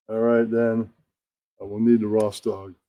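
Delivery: ordinary talk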